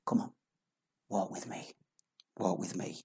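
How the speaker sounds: hushed tender invitation; tender Jesus voice. Acted. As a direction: lovingly call him back. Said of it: "And Jesus is saying".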